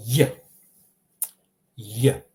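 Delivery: "j" as a short yuh; Y sound. Shown as a demonstration. Teacher introduces the Croatian letter, "j" as yuh. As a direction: say "j" like y in yield. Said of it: "more similar like the Y".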